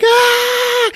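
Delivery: very high, loud hammed-up scream; mock scream. Woman trapped behind slammed door, mock scream. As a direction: shriek as the door slams. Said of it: "And she's like".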